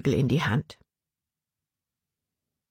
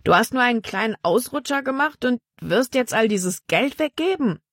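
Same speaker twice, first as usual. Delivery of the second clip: higher disbelieving bandit voice, rising to the end; a bandit who can't believe his ears; incredulous bandit; 8 semitones higher than usual. A narrator's reading.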